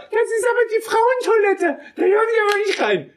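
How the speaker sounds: very high put-on voice of a scolding woman; woman shouting across. Woman shouting from across the restroom, very high put-on voice. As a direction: squeak it out like a shocked lady across the hall. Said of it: "schreit's von gegenüber".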